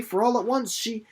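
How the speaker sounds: put-on a girl rushing out her plan; high eager young Winnie. Winnie voiced higher, eager.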